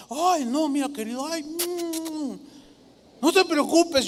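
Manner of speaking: flattering schemer. Retold or imitated. Preacher mimics Absalom soothing the people, flattering. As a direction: pour on the fake sweetness. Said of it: "con ese tono así lisonjero".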